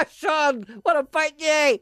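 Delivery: high, loud, hammed-up failed English accent; botched English. Coach trying it again and getting it wrong, botched English. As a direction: miss the English accent with a big greeting. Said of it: "I'm supposed to be English".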